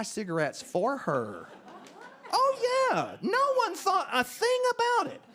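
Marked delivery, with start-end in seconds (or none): 2.3-5.2 very high, loud, incredulous-sounding insistence; high-pitched, forceful comic aside; emphatic assurance. Acted. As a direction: squeal it way up high to the crowd. Answering audience's shock, emphatic aside.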